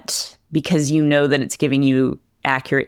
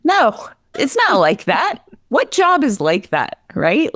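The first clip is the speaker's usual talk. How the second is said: exasperated no; 9 semitones higher than usual. Retold or imitated. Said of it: "And I'm like".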